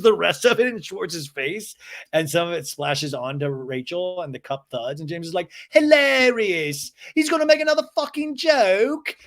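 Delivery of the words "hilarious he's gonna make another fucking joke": very high, loud mock outburst; James's sarcastic yelling. Recapper imitating James after throwing his drink, shrieking sarcasm. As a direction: stretch it out in shrieky imitation of outrage.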